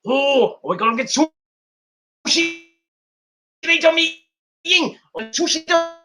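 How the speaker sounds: high, slow goofy taunting voice; lisping high-pitched mockery of a wrestler; mock Boa voice. Voicing Boa's high goofy quote.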